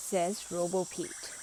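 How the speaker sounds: plain reading voice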